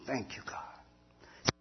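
slow, breathless whisper to heaven; whispered thanks. Real, not acted. Grateful prayer in police car.

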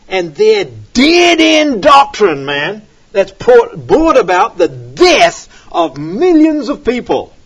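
loud, slow preaching build; forceful preaching. Acted. Preacher warning his congregation, loud and slow. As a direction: hammer the point home from the pulpit.

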